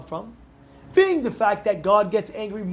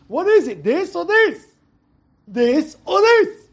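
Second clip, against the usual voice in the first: pitched up, insistent and exasperated; exasperated demand; 9 semitones higher, 6 dB louder, slower than usual. Real, not acted. Student pressing the rabbi, exasperated and insistent. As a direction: press him for a straight answer.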